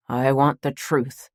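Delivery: slow, lightly voiced grandpa demanding; insistent old man. Grandfather gripping her hand, slowly demanding the truth. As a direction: press each word like a stubborn old man.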